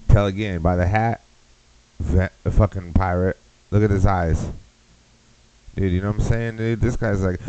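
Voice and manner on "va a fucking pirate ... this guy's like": ordinary delivery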